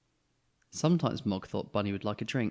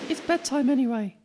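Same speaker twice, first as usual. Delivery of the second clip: brisk mum; 7 dB louder than usual. Acted. Mum hurrying family in.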